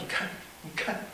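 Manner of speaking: very soft, half-whispered; acted upset man, barely getting it out; hushed mock-tearful reply; subdued reply. Crying roommate shows the scarf.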